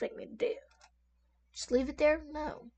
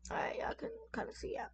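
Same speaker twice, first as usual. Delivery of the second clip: whisper; 10 semitones higher, 13 dB quieter, faster than usual. Real, not acted. Adjusting the screen.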